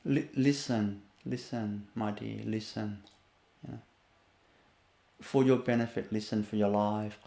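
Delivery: low, soft and steady; gently serious, no anger; calm and firm. Shown as a demonstration. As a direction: parent calmly urging the child to listen. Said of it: "Calm and firm".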